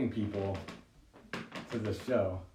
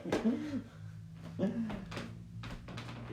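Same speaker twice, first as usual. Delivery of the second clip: high laugh; 5 dB quieter than usual. Real, not acted. Laughing at own joke.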